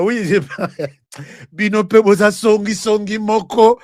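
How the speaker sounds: normal talking voice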